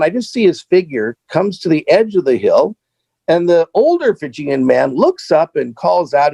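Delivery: ordinary delivery, nothing marked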